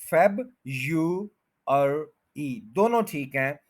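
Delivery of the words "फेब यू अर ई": फेब, यू, अर, ई slowly, one by one; Y variant. Shown as a demonstration. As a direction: separate syllables with a y sound: फेब, यू, अर, ई. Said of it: "वाई भी बोला गया है". (फेब यू अर ई, वाई के साथ)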